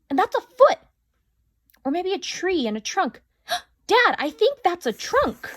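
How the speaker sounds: high and loud, piecing it together; rising thrill of discovery; excited. A narrator's reading. Julia finding a trunk in the picture, excited.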